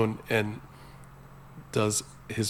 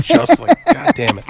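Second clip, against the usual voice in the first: louder, laughing exasperation; loud mock outrage; 12 dB louder than usual. Real, not acted. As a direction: blow up in fake annoyance, laughing. Mock indignation at friend.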